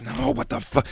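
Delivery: squeaky mock panic, way up high; high-pitched freakout. Fighting a neighbour, a high squeaky freakout. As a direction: yelp it high like you lost it.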